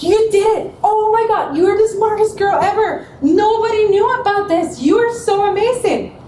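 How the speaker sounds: high, bubbly over-the-top praise; shower her with excited compliments; imitating her own gushing cheer. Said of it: "'cause I was like".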